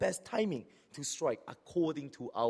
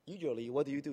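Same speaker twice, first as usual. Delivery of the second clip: ordinary talk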